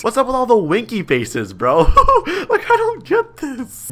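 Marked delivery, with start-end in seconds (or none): 1.9-3.9 high giggly laugh through the words; laughing in disbelief at the messages. Real, not acted. Player laughing at the girl's winky-face chat messages.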